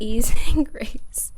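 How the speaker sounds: slow breathy whisper broken by a laugh; whispered giggle. Real, not acted. Tarot reader whispering the card message to viewers, giggling. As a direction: giggle through the words, barely voiced. Repeats the phrase, breaking into giggles.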